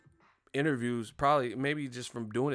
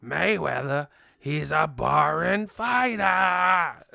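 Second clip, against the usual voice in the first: put-on loud, higher, slow cranky old promoter; cranky Bob Arum; 5 semitones higher, 10 dB louder, much slower than usual. Bob Arum complaining about Mayweather in an interview, cranky. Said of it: "damn near sound cranky".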